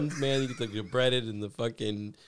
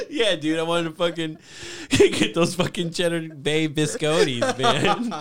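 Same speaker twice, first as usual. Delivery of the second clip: laughing; 6 semitones higher, 5 dB louder than usual. Acted. Mock craving, laughing.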